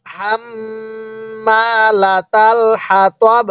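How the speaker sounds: long hummed double m in হাম্মালাতাল; slow tajweed reading. Shown as a demonstration. Teacher showing how to read with a stop, long nasal hum in হাম্মালাতাল. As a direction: stretch হাম্মালাতাল on a held nasal m, stop crisply on হাতব. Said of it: "এরকম ভাবে পড়ব".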